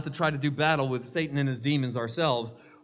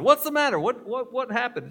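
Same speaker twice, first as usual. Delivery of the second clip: 1 upset husband; 9 semitones higher, 5 dB louder than usual. Retold or imitated. Husband upset at new dress.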